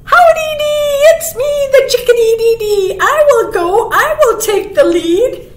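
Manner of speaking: high, loud, perky bird voice; fearless chickadee. A narrator's reading. Chickadee offering to take the lead, high and bold. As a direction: chirp it out boldly. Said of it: "He's not scared at all".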